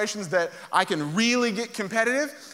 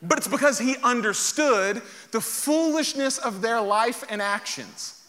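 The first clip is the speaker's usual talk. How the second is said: normal talking voice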